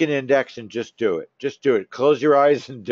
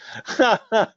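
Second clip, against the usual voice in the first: high laugh; 11 semitones higher than usual. Real, not acted. Laughing at member Chris.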